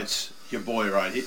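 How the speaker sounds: ordinary talk, nothing marked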